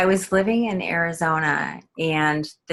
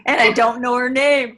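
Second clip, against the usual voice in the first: higher, louder repeat to be heard; raised-voice repeat; 6 semitones higher, 5 dB louder than usual. Real, not acted. Guest repeats herself to host who missed it, raised voice.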